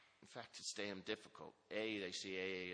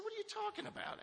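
incredulous. Retold or imitated. Told to hide his inmate status.

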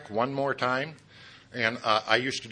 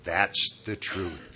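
slow stubborn claim; 6 semitones lower, much slower than usual. Retold or imitated. Mocking his own stubborn belief.

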